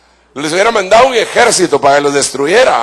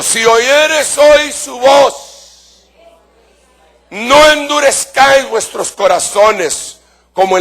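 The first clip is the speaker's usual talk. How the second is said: very slow, high, shouted scripture; preaching shout; 6 semitones higher, slower than usual. Real, not acted. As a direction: stretch each word out as a cry. Preacher quoting scripture to congregation.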